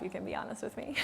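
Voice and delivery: hushed teasing whisper with a laugh; playful whisper. Real, not acted. Speaker whispering to audience to admit they came for breathwork. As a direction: lean in and whisper it conspiratorially.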